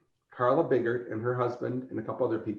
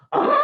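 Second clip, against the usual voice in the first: shouted mock dinosaur roar; imitated T-Rex roar; 7 dB louder than usual. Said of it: "there's the T-Rex going".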